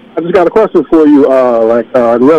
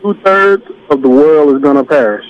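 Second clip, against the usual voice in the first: careful, spaced-out repeat for a listener; slow and clear. Shown as a demonstration. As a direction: repeat it slowly, word by word. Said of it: "speaking real clear and say it slowly".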